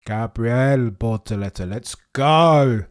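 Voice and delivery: loud cheer for the driver; excited cheer. Real, not acted. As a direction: hype it up loudly.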